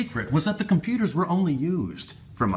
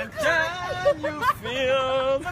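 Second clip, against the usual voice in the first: mock wailing; 12 semitones higher, much slower than usual.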